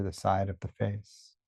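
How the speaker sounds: unhurried body-scan guidance with long pauses; guided meditation. Real, not acted. Teacher leads listeners through a body scan, calm meditation voice. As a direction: guide attention gently, leaving space after each phrase.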